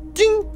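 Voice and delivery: very high pinged ding; chime ding. Pretend. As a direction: mimic a bell chime. Imitates lift chime.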